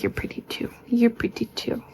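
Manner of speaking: whispered praise to a cat; soft unvoiced whisper to a cat. Real, not acted. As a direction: breathe it softly to the pet. Whispering sweetly to second cat.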